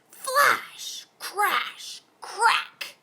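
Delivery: high punchy sound words; dramatic storm noises; storm sound effects. A narrator's reading. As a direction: snap each word like thunder.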